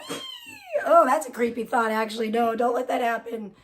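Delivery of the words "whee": high, drawn-out squeal; high mock-child cheer; kids' playful whee. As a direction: squeal like a kid jumping in. Voices kids jumping into water.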